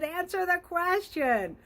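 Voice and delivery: high, slow words squeezed out through laughter; laughing. Real, not acted. Still laughing as she repeats her advice to the client. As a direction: stretch it out while still laughing.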